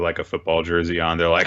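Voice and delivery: ordinary delivery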